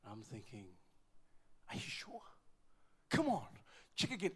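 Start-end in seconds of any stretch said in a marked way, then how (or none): 1.7-2.2 hushed, whispered question; near-voiceless retold disbelief; stunned disbelief. Stunned father questioning the doctor. 3.1-4.3 high, loud, urgent begging; acted-out frantic plea; desperate pleading. Father begging the doctor to recheck.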